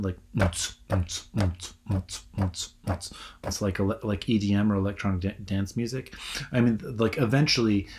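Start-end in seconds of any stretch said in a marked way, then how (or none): 0.3-3.1 "mts, mts" beatbox kick on a steady beat; imitating a bass drum. Teacher showing the house music beat, as a mouth drum. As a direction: tap out a four-on-the-floor kick with the mouth. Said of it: "you've got, like, a bass drum".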